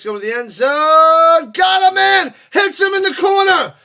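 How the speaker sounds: loud, high-pitched touchdown call; excited play-by-play. Real, not acted. Sportscaster calling a surprise touchdown to viewers, thrilled shouting. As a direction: erupt in disbelief, shouting the score.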